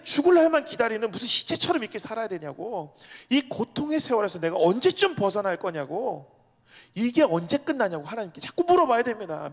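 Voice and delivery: loud, high, impassioned sermon voice; a preacher crying out God's questions to the congregation; fervent preaching. Acted. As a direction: preach it at full force, pleading with heaven. Preaching at full force.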